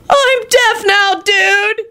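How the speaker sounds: very high, very slow mock shriek. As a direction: stretch out a high stoner shriek. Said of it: "he shrieked".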